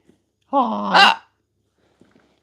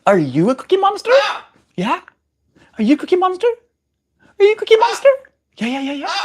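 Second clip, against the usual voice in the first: talking to a pet; faster than usual. Real, not acted. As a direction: chirp it at the bird. Baby talk to the bird.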